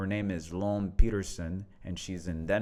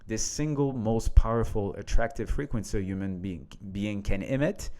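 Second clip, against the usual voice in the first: reading a post aloud; 5 dB louder than usual. Acted. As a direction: lean into each line as you read it out. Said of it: "I will read this".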